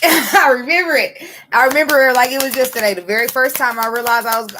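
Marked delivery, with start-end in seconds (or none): 0.0-2.9 higher, louder laughing recall; laughing as it all comes back; giggly, quick remembering. Real, not acted. Laughing recollection.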